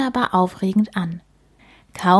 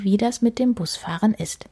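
usual speaking voice

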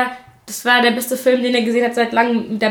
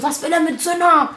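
retold incredulity; 5 semitones higher than usual. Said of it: "Ich so".

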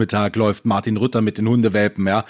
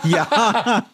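laughing; 9 semitones higher than usual. Real, not acted.

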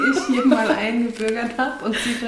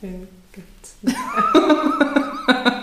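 laughing; 12 semitones higher, much slower than usual. Acted. Drawn-out joking line.

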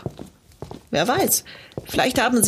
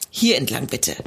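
normal talking voice

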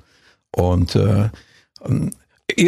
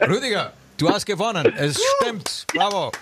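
booming prize announcer; 10 semitones higher, 3 dB quieter than usual. Acted. Announces the caller has won.

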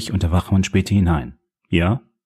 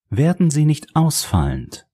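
high, slow, cutting warning; a sharp, slow reprimand; sharp rebuke; 8 semitones higher than usual. A narrator's reading. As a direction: put her firmly in her place. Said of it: "sagte Ashera Arnold scharf".

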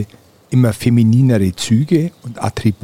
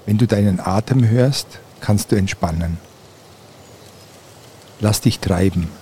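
guided meditation. Acted. Leads a guided relaxation.

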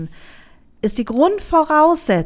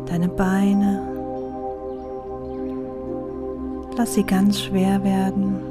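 soft spa whisper; 2 semitones lower, 6 dB quieter than usual. Acted. Leads a meditation over music. (ruhige, langsame Meditationsstimme)

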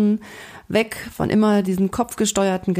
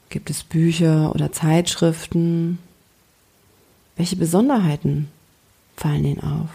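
guided meditation. Acted. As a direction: gently lead a daydream. Guides a calm visualization. (ruhige Fantasiereise-Stimme)

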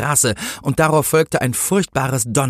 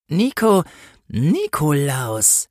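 higher, drawn-out echo of a name; pondering policewoman; 6 semitones higher, much slower than usual. A narrator's reading. Policewoman repeats the accomplice's name, high, slow and suspicious. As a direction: repeat it very slowly, full of suspicion. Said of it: "wiederholte die Politesse".

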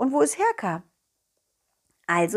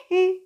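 high giggle; 7 semitones higher than usual. Acted. Ball character giggles.

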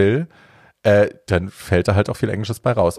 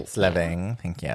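vocal fry endings; 3 semitones lower, 8 dB quieter than usual. Retold or imitated. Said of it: "er hat auch ein bisschen Vocal Fry".

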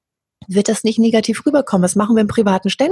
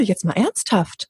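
ordinary talk, nothing marked

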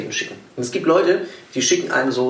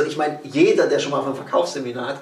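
ordinary delivery

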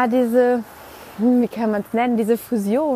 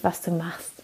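whispered; 5 semitones lower, 10 dB quieter than usual. Real, not acted. Intimate point about holiness.

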